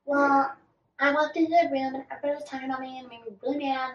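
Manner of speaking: imitating Shanae making excuses to Clayton; mocking Shanae. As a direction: rush the excuse like a whiny reality star. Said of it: "she's like".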